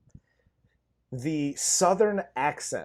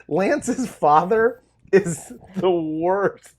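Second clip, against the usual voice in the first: slow emphatic; 3 semitones higher, 7 dB louder, much slower than usual. Real, not acted. Ranting about bad accent.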